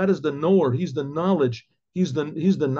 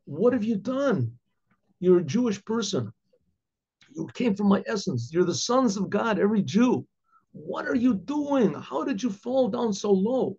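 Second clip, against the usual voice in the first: pitched-up, imploring questions; God's appeal; 5 semitones higher than usual. Retold or imitated. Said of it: "God is saying to us".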